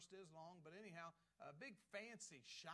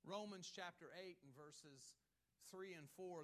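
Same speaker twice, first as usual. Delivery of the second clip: ordinary talk, nothing marked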